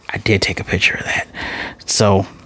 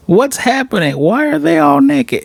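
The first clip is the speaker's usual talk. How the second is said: high, baffled squeak. Pretend. Mock bafflement at episode end.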